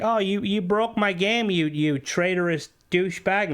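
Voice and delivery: high-pitched whiny outrage; mock angry commenter. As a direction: throw a petulant fit at the modder. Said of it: "getting lambasted in their comments and stuff like".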